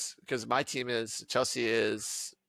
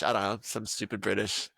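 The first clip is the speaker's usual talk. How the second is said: usual speaking voice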